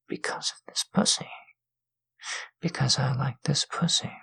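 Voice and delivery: soft close intimate whisper. Acted. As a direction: breathe every word into the mic.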